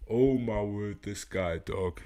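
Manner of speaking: ordinary delivery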